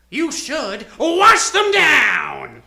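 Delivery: slow, drawn-out mocking shout; taunting shout. Clown taunting the detective, slow mocking shout. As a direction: yell it down at him with glee.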